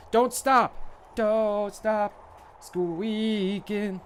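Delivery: high, loud cheering shouts; hyped shouting. Pretend. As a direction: whip the crowd up.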